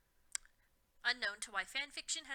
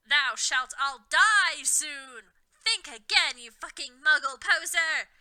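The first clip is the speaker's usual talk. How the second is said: very loud, slow, raised yell; 3 semitones higher, 11 dB louder, slower than usual. A narrator's reading. Harry defying Voldemort, loud slow yell. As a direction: yell the defiance at the villain. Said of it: "Harry yelled".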